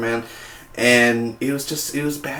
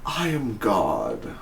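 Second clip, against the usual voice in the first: slow hypnotic God voice; 5 semitones lower, 7 dB quieter, much slower than usual. Retold or imitated. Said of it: "he's like".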